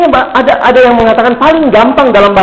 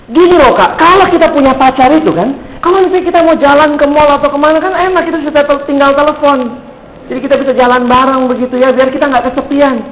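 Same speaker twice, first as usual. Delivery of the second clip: higher-pitched imitation of a cheeky schoolboy; acted kid making his case; 6 semitones higher than usual. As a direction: explain it like a cocky youngster, pitched up. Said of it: "satu anak yang lebih tengil lagi".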